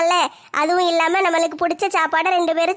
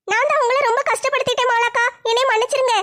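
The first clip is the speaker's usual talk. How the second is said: tearful younger sister; 6 semitones higher than usual. Acted. அழாதம்மா.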